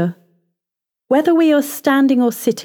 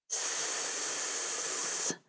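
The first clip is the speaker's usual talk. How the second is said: long unvoiced sh breath out; shushing exhale; 20 dB quieter than usual. Shown as a demonstration. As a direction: blow the air out on a steady sh. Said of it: "Blow the air out with a sh".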